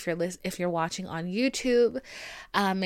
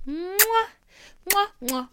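big wet smooch; 12 semitones higher, much slower than usual. Acted. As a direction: blow big smacking kisses. Blowing kisses goodbye.

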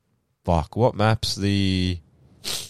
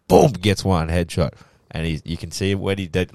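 usual speaking voice

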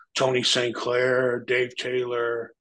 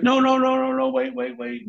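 high, frantic no no wait; imitating a panicked plea; panicked pleading; 12 semitones higher, 5 dB louder than usual. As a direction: beg him to stop, pitched way up. Man panics, pleads to stop.

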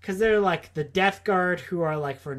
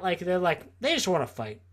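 normal talking voice

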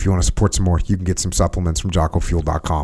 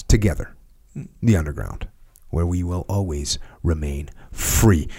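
dramatic outro; slower than usual. Acted.

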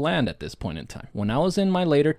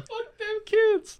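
high drawn-out echo; 4 dB quieter, much slower than usual. Real, not acted. Echoes caption joke.

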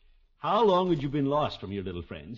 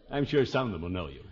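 ordinary delivery

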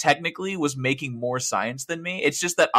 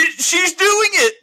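excited; 7 dB louder, slower than usual. Retold or imitated. Retold excitement at AI singing.

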